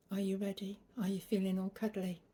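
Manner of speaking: ordinary delivery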